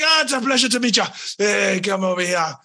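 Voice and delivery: jolly dwarf florist greeting; cheery dwarf. Acted. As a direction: welcome them like a beaming dwarf. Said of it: "he's like".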